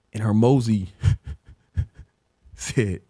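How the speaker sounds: ordinary delivery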